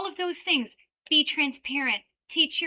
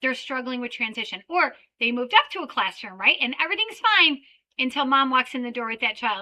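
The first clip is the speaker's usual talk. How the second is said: ordinary talk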